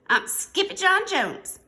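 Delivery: high, loud, defiant kitten; proud declaration. A narrator's reading. Skippy John Jones insisting he is a chihuahua, proudly declaring. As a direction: announce the name with a high flourish.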